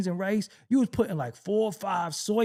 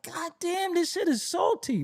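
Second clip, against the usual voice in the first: mock old complainer; 9 semitones higher than usual. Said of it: "be like".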